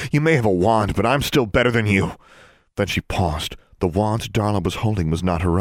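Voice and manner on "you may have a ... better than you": higher, louder taunt; taunting boast with raised pitch. A narrator's reading. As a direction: crow over a rival. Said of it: "waved her wand tauntingly".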